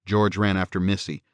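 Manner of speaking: plain reading voice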